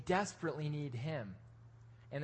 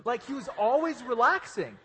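amused emphasis; 6 semitones higher, 5 dB louder than usual. Real, not acted. Laughing about Pablo.

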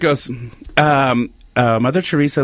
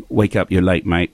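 low English accent, lightly put on; God with an English accent; 10 semitones lower than usual. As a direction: say it like an English God waking someone. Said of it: "That's a great accent".

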